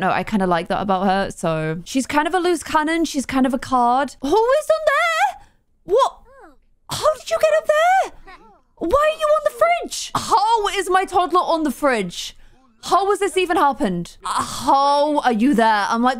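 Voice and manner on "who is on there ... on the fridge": much higher, stretched out in disbelief; astonished. Real, not acted. Toddler suddenly on the fridge.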